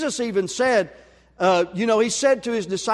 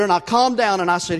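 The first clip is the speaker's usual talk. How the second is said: ordinary delivery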